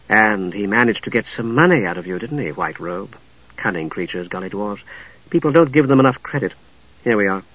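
low, hushed, knowing woman; composed lady knight. Acted. Lady knight owning up to her lookout, quietly knowing. Narrator voices Lady Catherine.

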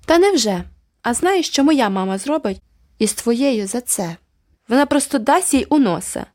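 mock his threat; taunting. A narrator's reading. Girl taunting boy.

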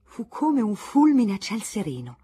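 ordinary delivery

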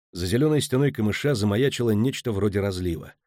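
plain reading voice

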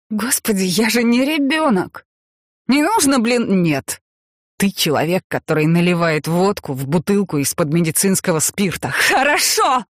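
высокий возмущённый крик; сорвись на мужа, к концу до крика; сердитый крик. A narrator's reading. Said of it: "Почему ты кричишь на меня?". (раздражённо кричит)

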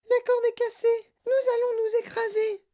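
very high, shrieky falsetto; panicked cry. A narrator's reading. Mother believes the falling lift will crash, shrieking. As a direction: cry out in sheer terror. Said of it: "Et madame T V s'écria".

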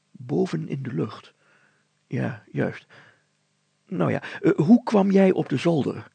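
a mayor who can't quite believe her; doubtful mayor. Acted. Mayor questioning the girl, in disbelief.

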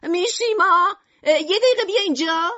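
high, loud call to someone far off; shouting. Acted. Shopkeeper wife calls her husband up from below, shouting. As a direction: yell impatiently for him to come. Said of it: "داد زد".

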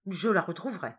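plain reading voice

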